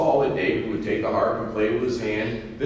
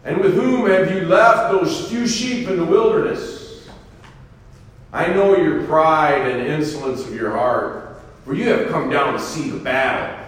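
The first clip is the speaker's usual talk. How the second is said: a touch of raised, scolding anger; older brother snaps at David, mildly raised; angry older brother; 5 semitones higher, 5 dB louder than usual. A narrator's reading. Eliab scolds his youngest brother, mild anger. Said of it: "Eliab's anger was aroused".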